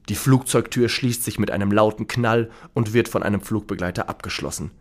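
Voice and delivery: ordinary delivery, nothing marked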